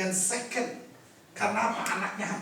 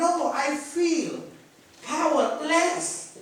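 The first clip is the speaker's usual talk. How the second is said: drawn-out Jesus; 7 semitones higher, much slower than usual. Acted. Jesus voiced.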